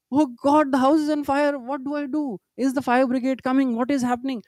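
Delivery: high, anxious cry of a worried father; mildly voiced panic at the news; panicked father. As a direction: fret as if the house burns.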